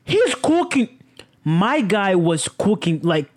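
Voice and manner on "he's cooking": high stunned what; shocked what. Retold or imitated. Reacting to the judge letting Jeff off, shocked. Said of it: "I'm like".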